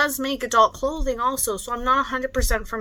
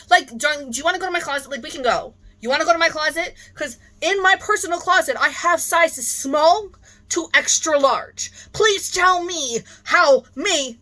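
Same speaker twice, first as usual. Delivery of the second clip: heated rant; 6 semitones higher, 4 dB louder than usual. Real, not acted.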